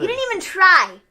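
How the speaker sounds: high, loud teasing; playful hammed-up scolding; loud mocking. Real, not acted. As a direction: shout the tip at him. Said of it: "demo of how to do it".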